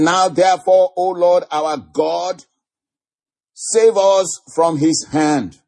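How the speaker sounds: high, slow, drawn-out plea to God; preacher intoning a king's plea; declaimed prayer. Acted. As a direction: cry the prayer out slowly, word by word.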